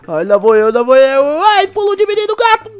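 loud, building whoop as he leaps; excited jump cry. Real, not acted. To lagartixo, jumping down from the treehouse, loud excited whoop. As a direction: psych yourself up and launch, shouting.

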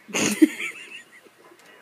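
high breathy squeal of laughter. Real, not acted. Kid squeals with laughter at the game. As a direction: let a shrill laugh burst out.

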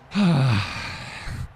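long heavy breath out; loud exasperated sigh. Real, not acted. Gamer letting down after a play, heavy sigh. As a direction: let out a big frustrated sigh.

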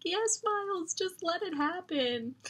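urging the hero on. Retold or imitated. Reader urging Miles on, high and eager. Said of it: "I was just like".

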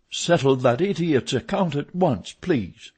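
mildly furious command; foaming mad. A narrator's reading. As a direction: order it in irritation. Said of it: "Richard foamed at the mouth".

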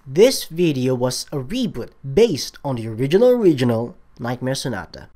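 ordinary delivery

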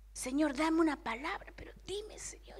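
breathless whisper begging God; a private whispered plea to the Lord; whispered prayer. Retold or imitated. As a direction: plead in a hushed whisper.

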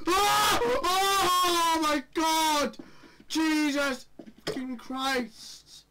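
high, loud, slow terrified cry; terrified scream. Real, not acted. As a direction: scream then drag it out in fear. Jump scare.

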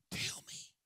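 breathy whisper, pleading; whispered plea. A narrator's reading. Mary begging the gardener where he laid the body, whispered. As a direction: beg it under your breath.

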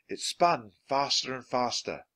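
plain reading voice

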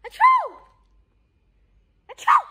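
loud exaggerated fake achoo; fake sneeze.

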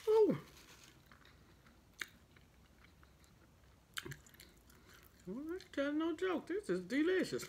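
eating and savoring; talking while chewing. Real, not acted. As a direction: mouth partly full of food. Seen 5: Ate at -12s; mouth keeps working while she picks food.

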